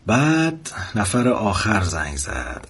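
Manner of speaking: usual speaking voice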